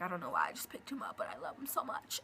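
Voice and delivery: soft whispered aside; whispered confession. Real, not acted. Admitting to viewers she's allergic, whispering. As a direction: whisper it like a secret to the camera. Confiding aside holding dog.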